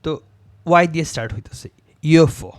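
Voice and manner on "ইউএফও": ইউএফও as yoo-eff-oh, Y sound first; ইউএফও pronounced with initial yo. Shown as a demonstration. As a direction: say ইউএফও starting with a Y glide, yo-fo. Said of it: "ইউটার যে উচ্চারণটা হচ্ছে ... ইয়ো".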